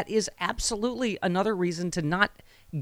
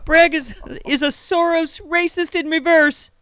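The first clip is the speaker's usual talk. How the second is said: slow, high mock politician's voice; put-on high-pitched ranting voice; Trump; 11 semitones higher, 4 dB louder, slower than usual. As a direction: squeak out the accusation slowly in caricature. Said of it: "real voice is actually that high pitched".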